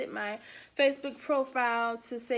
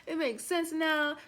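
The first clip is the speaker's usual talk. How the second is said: normal talking voice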